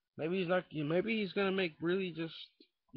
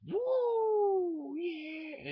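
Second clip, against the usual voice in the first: excited whoop; 13 semitones higher than usual. Acted. Excited whoop about being Jedi.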